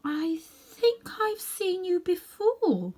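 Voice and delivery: high, slow and dreamy; a contented girl voice, very slow and high. A narrator's reading. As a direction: drift the words out half asleep. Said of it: "Then she spoke in a dreamy, contented sort of voice".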